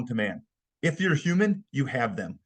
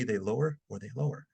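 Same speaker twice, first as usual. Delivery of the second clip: demonstrating a voice dropping away, softer; lowering voice; 3 semitones lower, 5 dB quieter than usual. Shown as a demonstration. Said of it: "from a three to a two to a one".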